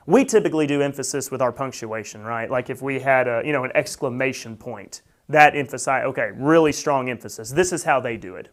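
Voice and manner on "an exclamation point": usual speaking voice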